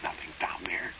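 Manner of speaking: shaky reassuring whisper; hushed whisper. Acted. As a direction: whisper it to calm her. Trying to reassure in the dark.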